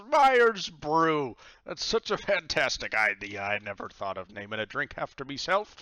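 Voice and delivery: loud, high, delighted pirate captain; thrilled pirate god. Acted. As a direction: gush over the name.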